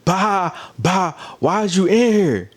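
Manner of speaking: high-pitched mock dismissal, each bye set apart. Imitating a reality star kicking someone out, mock dismissive. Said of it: "how Low London did on B G C twelve".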